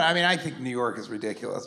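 ordinary talk, nothing marked

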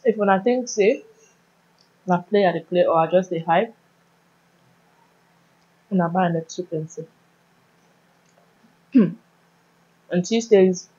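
speaking with food in mouth; mumbled eating speech; talking while chewing. Real, not acted. Seen 1: Bit food at -5, chewing-like mouth while talking through labelled words.

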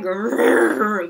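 hammed-up monster growl; playful angry growl. Shown as a demonstration. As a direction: growl like a cross little monster for kids. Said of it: "and I'm like".

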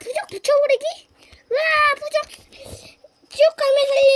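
high, loud battle shout; excited shouting. Pretend. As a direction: yell while slapping the talisman on. Shouting while slapping the talisman on.

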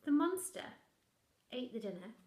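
ordinary delivery

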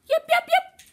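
high, loud yipping call; loud fox yips. Acted. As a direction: call out with all her little fox might. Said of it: "She called".

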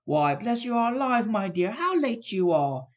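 high, slow motherly fuss; Mrs Cratchit greeting. Acted. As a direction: welcome the late daughter. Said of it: "said Missus Cratchit".

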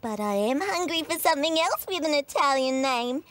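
usual speaking voice

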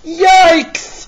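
high, loud startled yelp; a cartoon dog caught off guard, yelping; startled yikes. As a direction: jump back with a yelp of shock. Hero spots the crooks.

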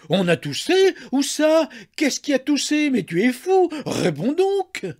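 high, loud, incredulous questioning; outraged demand, pitched high; exasperated cry. A narrator's reading. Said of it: "s'écria exaspérée".

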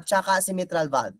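normal talking voice

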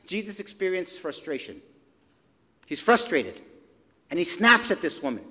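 ordinary talk, nothing marked

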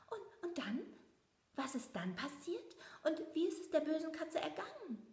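high, breathless eager questions; a child who can't wait for the ending; excited little mouse. A narrator's reading. Leo presses his mother for the rest of the fairy tale. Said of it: "fragt ganz aufgeregt".

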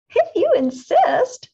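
very high, loud, slow chirp; a bird calmly accepting; sparrow voice. A narrator's reading. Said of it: "said the sparrow".

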